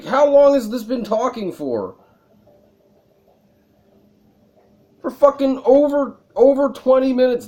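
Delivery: loud, high, laughing exasperated shouts; annoyed yelling. Real, not acted. Streamer yelling at a character who won't stop talking, exasperated. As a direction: scream at the screen in disbelief. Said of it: "I'm just getting annoyed".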